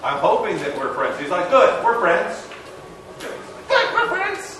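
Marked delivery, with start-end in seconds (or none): 3.2-4.6 high, squeaky mock Boston accent. Teller redoing his friend accepting the friendship, in a mock Boston accent.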